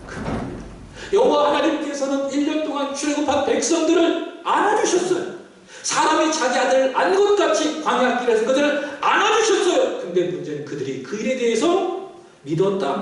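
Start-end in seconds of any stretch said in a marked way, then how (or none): 1.1-10.0 higher-pitched emphatic proclamation; impassioned sermon voice; fervent preaching. Real, not acted. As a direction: declare it firmly from the pulpit. Preaches at full force to congregation.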